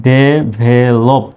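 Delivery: "Develop" stretched out syllable by syllable; very slow model word. Shown as a demonstration. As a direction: drag the word out for learners. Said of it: "এভাবে ভেঙে ভেঙে উচ্চারণ করবেন". (খুব ধীরে টেনে "develop")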